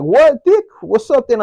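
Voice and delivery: high, loud angry challenge; defiant challenge. Retold or imitated. Facing a room of homies who turned on him, loud challenge. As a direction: dare them to step up.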